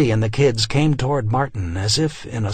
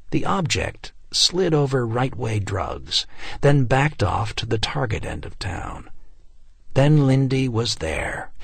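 plain reading voice

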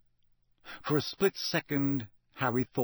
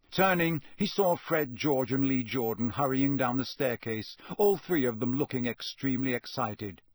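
usual speaking voice

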